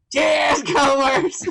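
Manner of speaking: big, high, loud cheer; shouted toast. Real, not acted. Toasting the commenters with his co-host, loud high shout. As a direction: holler the toast out with a laugh behind it.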